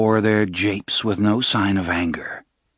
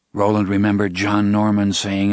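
ordinary delivery, nothing marked

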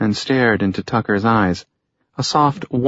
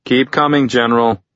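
higher-pitched call across a distance; 4 semitones higher, 4 dB louder than usual. A narrator's reading. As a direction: call him onward, voice lifted. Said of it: "he called out".

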